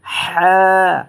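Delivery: হ্যাঁ breathed from mid-throat, high, loud and slow; a drawn-out breathy হ্যাঁ like a gasp of surprise; throaty ha. Shown as a demonstration. Teacher to students, a breathy throat হ্যাঁ. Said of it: "এইভাবে".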